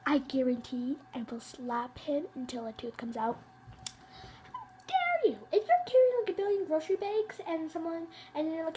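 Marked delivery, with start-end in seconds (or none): 4.5-7.4 high, loud how-dare-you; mock outrage. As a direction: play up a huge indignant gasp. Indignant outburst.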